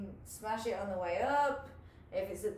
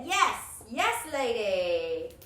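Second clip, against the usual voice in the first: excited cheer; 8 dB louder, much slower than usual. Real, not acted. Coach cheers a student who nails the move, loud and slow. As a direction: celebrate her win out loud.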